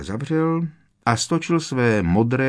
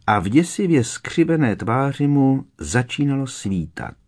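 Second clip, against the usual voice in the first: plain reading voice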